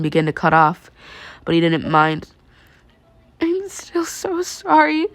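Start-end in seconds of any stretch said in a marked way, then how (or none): 3.4-5.1 high, slow, choked apology; a girl choking back tears. Acted. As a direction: force it out through a tight throat. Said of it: "she choked out".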